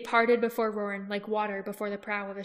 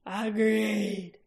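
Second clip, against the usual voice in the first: throaty growl. Acted. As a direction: growl the word Agreed like a werecat king. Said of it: "drawing the word out into a throaty growl".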